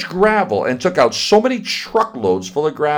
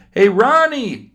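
high, loud call across the yard; hollering; 10 semitones higher, 4 dB louder than usual. Acted. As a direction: shout to a friend far off. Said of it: "I started hollering".

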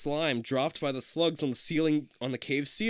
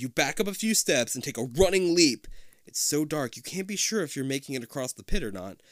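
ordinary delivery

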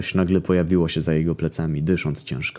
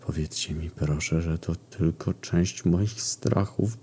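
much softer, very slow whimpering plea; panicked moaning plea; 7 dB quieter, slower than usual. A narrator's reading. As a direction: beg under your breath in dread. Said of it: "zaczął jęczeć panicznie".

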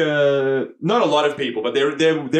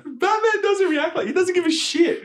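laughing. Real, not acted. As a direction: squeal it out between laughs. Laughing mock outrage at Batman.

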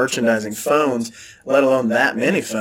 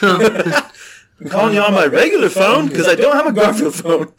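higher, laughing caller voice; hammed-up customer griping down the phone; mock complaining caller; 7 semitones higher, 4 dB louder than usual. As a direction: laugh through a put-on complaint.

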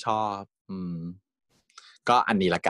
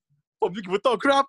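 slow, higher put-on voice of the character; quoting the character; 8 semitones higher, 4 dB louder, slower than usual. Retelling a funny scene, a put-on character voice. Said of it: "แล้วเจปาปาพูดว่า".

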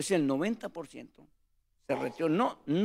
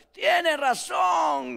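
high, stretched-out call of agreement; crowd shout; 10 semitones higher, 4 dB louder, much slower than usual. Retold or imitated. Churchgoer in the crowd backing the preacher, drawn-out shout.

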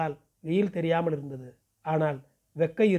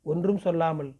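plain reading voice

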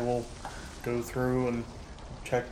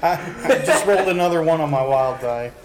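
louder, higher, words through a laugh; laughing disbelief; 4 semitones higher, 6 dB louder than usual. Real, not acted. Player telling the table about the wild die, laughing. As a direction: laugh while reporting the absurd roll.

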